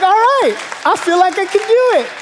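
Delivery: high, loud burst of confidence; hammed-up kid psyched by a pep talk; pumped up. Small son answering dad's encouragement, pumped up.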